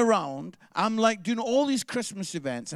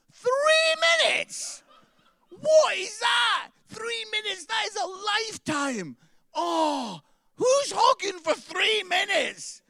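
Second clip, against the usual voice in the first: much higher, louder, slow incredulous yelling; outraged shout; 12 semitones higher, 4 dB louder, slower than usual. Real, not acted. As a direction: shout it up high, appalled at the rule. Outraged at the hug limit.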